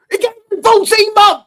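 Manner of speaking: hammed-up shriek of outrage; mock outraged shout. Mocking the other host's patience plea.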